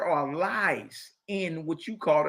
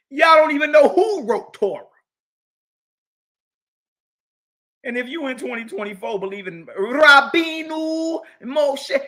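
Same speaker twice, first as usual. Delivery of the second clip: loud, high challenge to listeners; preacher building to a shout; preaching shout; 7 semitones higher, 6 dB louder than usual. Real, not acted. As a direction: call them out at full force. Preacher rising at audience.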